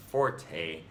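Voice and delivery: forte in two syllables, FOR-tay; English pronunciation. Shown as a demonstration. Speaker insists on the English way, two-syllable forte. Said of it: "how we pronounce it in English".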